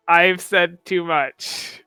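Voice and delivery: ordinary talk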